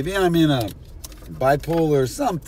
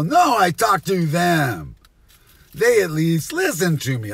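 indignant retort; 5 semitones higher, 5 dB louder than usual. Retold or imitated. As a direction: insist loudly, correcting them. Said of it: "And I go".

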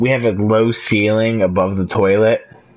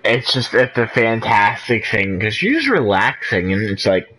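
ordinary talk, nothing marked

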